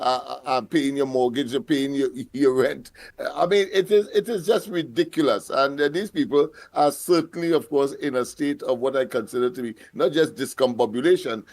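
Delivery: normal talking voice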